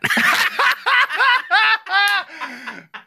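real high, loud burst of laughter; big laugh. Real, not acted. Cracking up at co-host's old post, big laugh. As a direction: crack up in a long high cackle.

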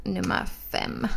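slow, soft whisper; ASMR whisper. Pretend. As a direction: draw it out in a mock ASMR whisper. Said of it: "börja tala så här istället". (långsam ASMR-viskning)

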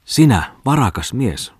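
loud, high-pitched exclamation; exclaiming. A narrator's reading. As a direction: cry out in reproach at a friend. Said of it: "huudahti Oivo Tommola".

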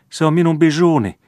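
put-on high, loud, frantic shriek of a little girl. A narrator's reading. Little Veera shrieks at her brother to save her lamb, high and loud. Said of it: "kiljaisi hän".

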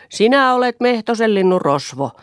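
high loud accusing yell; high shouted insult; shouted accusation. A narrator's reading.

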